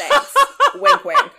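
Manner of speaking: laughing flirtatious joke; flirty laughing. Acted. Flirty joke about actor.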